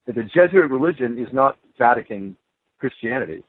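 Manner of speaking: ordinary delivery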